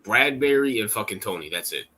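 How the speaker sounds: ordinary talk, nothing marked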